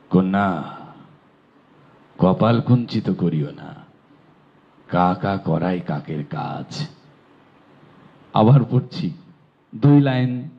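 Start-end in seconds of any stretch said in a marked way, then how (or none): 0.1-6.9 lower, slower uncle voice; uncle's voice. Acted. Uncle gently scolding his niece, lower and slower. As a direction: give the uncle a lower, measured reply. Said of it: "তিনটি আলাদা চরিত্র".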